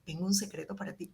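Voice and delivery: right way to share a secret; secretive hush. Shown as a demonstration. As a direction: lean in and confide it quietly. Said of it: "no, yo te digo".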